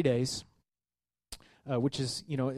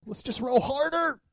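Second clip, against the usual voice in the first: upbeat sailors; 11 semitones higher, 4 dB louder than usual. Acted. Sailors' resolve, joked.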